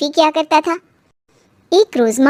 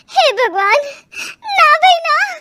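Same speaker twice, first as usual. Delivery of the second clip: high, alarmed outburst; panicked refusal; 10 semitones higher than usual. Acted. Overwhelmed daughter-in-law blurting aloud, in a panic. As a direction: leap up in pitch, recoiling in dread. Blurts out dread of all the chores.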